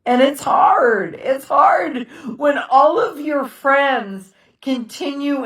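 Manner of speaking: real tears, the words forced out through crying. Real, not acted. Widow telling viewers how hard it is, crying. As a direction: voice breaking into tears, slow at first. Widow talking about her grief.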